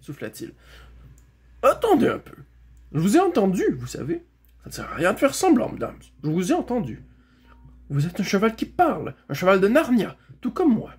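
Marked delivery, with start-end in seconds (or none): 1.6-10.9 high, insistent horse voice; excited challenge. Acted. Bré confronting another talking horse, excited and insistent. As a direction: call them out, high and emphatic.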